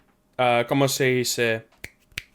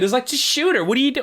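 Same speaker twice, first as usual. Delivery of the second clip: normal talking voice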